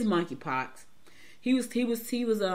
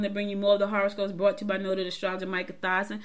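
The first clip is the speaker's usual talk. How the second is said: ordinary delivery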